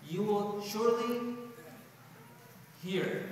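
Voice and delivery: "surely" slowed right down before "hear"; emphatic surely. Shown as a demonstration. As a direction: drag "surely" out, then pause before "hear". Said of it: "two articles of emphasis".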